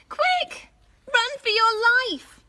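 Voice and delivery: high, loud urgent cry; panicked dinosaur. A narrator's reading. Hadrosaurus warning Katy of the T. rex, high and panicked. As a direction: yell the warning in alarm. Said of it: "said Hadrosaurus".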